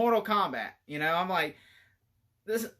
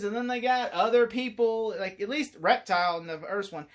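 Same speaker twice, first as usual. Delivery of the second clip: normal talking voice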